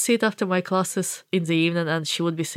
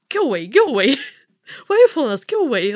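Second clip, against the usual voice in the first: higher, louder, mocking brush-off; mock shooing strangers; 7 semitones higher, 5 dB louder, much faster than usual. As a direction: play annoyed strangers shooing her off. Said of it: "they're like".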